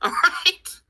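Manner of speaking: high, laughing all right. Real, not acted. Laughing as she resolves to tell viewers. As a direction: laugh it off and give in.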